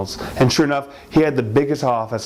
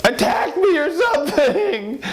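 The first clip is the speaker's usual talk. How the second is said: high, strained female voice throughout; wife's frantic voice; 12 semitones higher than usual. Acted. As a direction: pitch way up like a panicked wife. Wife recounts possessed night.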